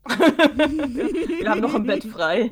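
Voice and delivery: laughing through a slow teasing offer. Real, not acted. Inviting her friend along while laughing. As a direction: giggle the offer out, slowly.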